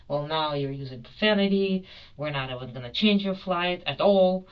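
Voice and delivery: loud, rising rebuke in imitation; retold stern refusal. Airline agent refuses swearing passenger, retold stern refusal. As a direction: lay down the rule emphatically, mimicking an agent.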